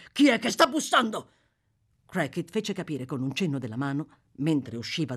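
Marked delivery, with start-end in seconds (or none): 0.1-1.3 sudden high, loud, jumpy question; startled, alarmed. A narrator's reading. Fugitive hears knocking at the door, startled and loud. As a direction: jump at the noise and demand an answer.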